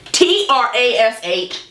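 T R A S H spelled letter by letter, slowly. Shown as a demonstration. Vlogger taunting a rival by spelling out trash slowly. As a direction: spell out T R A S H one letter at a time to rub it in.

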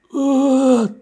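high, drawn-out eerie moan; imitated ghostly groan from the toilet. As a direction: moan like something unseen in the toilet. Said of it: "という声が聞こえて".

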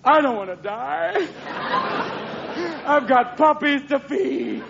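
high, slow, whining dog plea; hammed-up tearful high pleading; terrified bomb-squad dog. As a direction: wail it like a dog fearing death. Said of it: "dogs who work in the bomb squad going".

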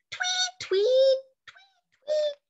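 very high whistled tweets to call the dog; high bird whistle calling the dog back; chickadee call. Pretend. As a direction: pipe it like a bird. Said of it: "the same sound I make".

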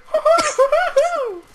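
high hooting, mocking a hopping zombie; mock monkey hoots. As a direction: hoot like a bouncing ape. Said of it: "He's like".

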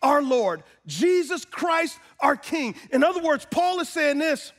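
high-pitched, ringing sermon voice; full-force preaching. Acted. Preacher gives the church Paul's answer in Jesus, full-force preaching. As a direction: proclaim it to the whole church.

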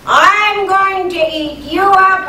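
big goat threat. Acted. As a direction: boom it out slowly. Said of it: "came to him and said".

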